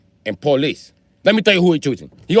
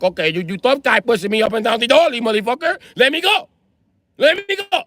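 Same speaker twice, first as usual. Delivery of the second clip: high-pitched yelling; 8 semitones higher than usual. Retold or imitated. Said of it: "I'm like".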